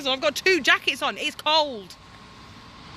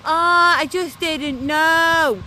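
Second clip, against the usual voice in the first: slow, whiny mock excuse; mocking the ignorant; 2 semitones higher, much slower than usual. Mocking ignorant people who later claim they didn't know, slow and whiny. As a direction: drawl it out like a clueless excuse. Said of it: "the ignorant are going".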